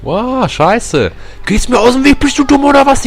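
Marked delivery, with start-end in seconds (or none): 1.5-3.0 high, loud furious yell; angry shout. Real, not acted. Gamer shouting at a character blocking his path, loudly furious.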